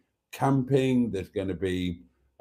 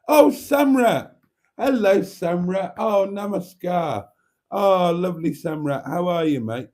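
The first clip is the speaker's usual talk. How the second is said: delighted greeting; 7 semitones higher, 5 dB louder than usual. Real, not acted. Welcoming Samra to the stream, overjoyed.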